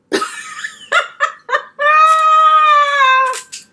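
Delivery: high, loud burst of laughter; loud shrieking laugh. Real, not acted. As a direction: cackle out loud in disbelief. Pie gone, big reaction.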